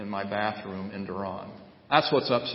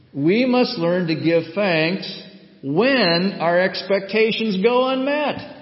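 "learn" emphasized within a slow, loud, high line; stress on LEARN; 8 semitones higher, 7 dB louder, slower than usual. Real, not acted. Preacher teaching thanks for unmet expectations, stress on "learn". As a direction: lean on "learn", slowly and firmly. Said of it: "I emphasize the word learn there".

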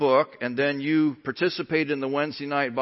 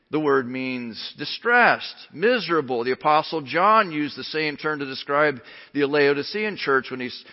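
ordinary talk, nothing marked

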